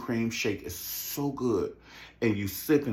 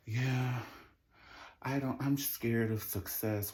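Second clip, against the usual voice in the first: soft, slow imitation; mock listless excuse; 8 dB quieter, slower than usual. Mimicking Robin's sleepy excuse about success, soft and slow. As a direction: drag it out quietly, mimicking her.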